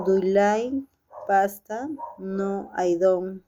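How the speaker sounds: normal talking voice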